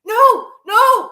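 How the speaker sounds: high, loud desperate protest; retold panic. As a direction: imitate the panic of losing your voice. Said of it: "I was like".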